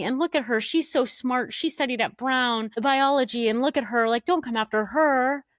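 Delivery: high, whiny mock of Johnny showing off; mock arrogant Johnny. Podcaster mocking Johnny's speech to the house, high and whiny. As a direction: play the smug guy pleading for his partner. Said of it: "trying to be like".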